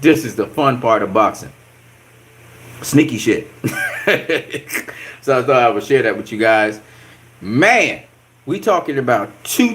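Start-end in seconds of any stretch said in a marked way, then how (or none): 3.5-5.2 soft amused chuckle. Real, not acted. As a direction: chuckle under your breath at a sly trick. Amused by sneaky boxing tactics.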